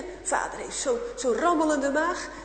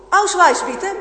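loud, higher barked German command; German soldier shouting; 7 semitones higher, 13 dB louder, slower than usual. Acted. German soldier shouting at the father for his papers. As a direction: bark it out like a soldier at a checkpoint. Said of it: "ze roepen hem al".

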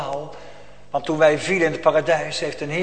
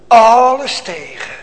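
slow shouted cry; 7 semitones higher, 12 dB louder, slower than usual. Acted. First take, shouted.

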